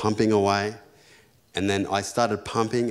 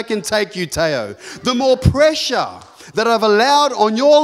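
loud, high full-force preaching; 12 semitones higher, 4 dB louder than usual. Acted. Pastor urging the congregation to be grateful, loud and high. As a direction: preach it to the rafters.

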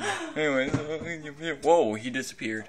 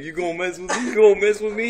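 mock confrontation; 6 semitones higher, 9 dB louder, much faster than usual. Voicing a guy picking a fight, mock confrontational. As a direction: challenge building to a high, loud repeat. Replays the challenger, building.

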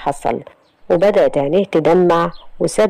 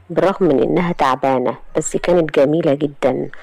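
plain reading voice

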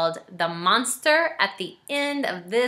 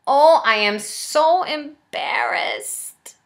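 slow, sheepish monster voice; embarrassed Grover; 6 semitones higher, 3 dB louder, much slower than usual. Acted. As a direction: Grover admitting he's embarrassed. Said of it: "He says".

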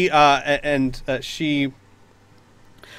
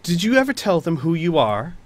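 louder taunting, higher; mocking villain; 5 semitones higher, 5 dB louder than usual. Knight taunting Finn, scornful. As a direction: sneer at her.

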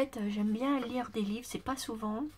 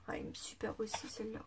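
quick hushed whisper; 7 dB quieter, faster than usual. Real, not acted. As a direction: rush it out under your breath. Whispered admiration after throat clear. (chuchoté rapidement)

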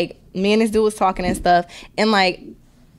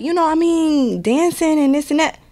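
smooth talker; 7 semitones higher than usual. Retold or imitated. Voicing the date's pitch.

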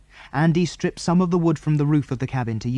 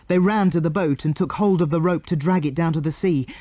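usual speaking voice

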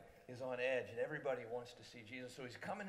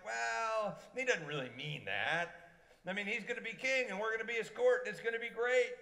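higher, louder giddy hope; eager disciples; 8 semitones higher, 7 dB louder than usual. Retold or imitated. Disciples picturing their king, giddy and loud. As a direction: sell the dream of a coming kingdom.

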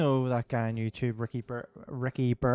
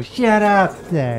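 slow, higher, louder barked catchphrase; South Park catchphrase; 7 semitones higher, 6 dB louder, much slower than usual. Retold or imitated. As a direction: stretch each word out like a cartoon bully. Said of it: "remember I used to go".

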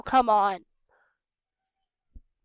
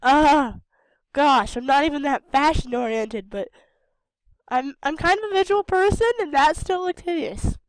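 outraged; 4 semitones higher, 9 dB louder than usual. Real, not acted. Shocked at ugly outfit.

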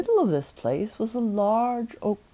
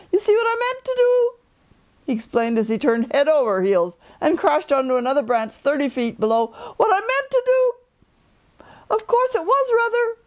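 startled bear; 11 semitones higher, 4 dB louder than usual. A narrator's reading. Pooh explains while falling.

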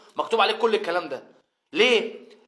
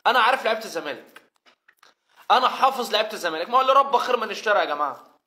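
ordinary talk

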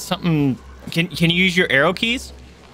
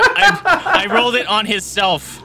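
laughing through the words; chuckling while admitting a mistake; 7 semitones higher, 4 dB louder than usual. Real, not acted. GM laughs at his own misroll.

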